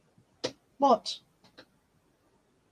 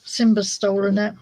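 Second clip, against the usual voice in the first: ordinary delivery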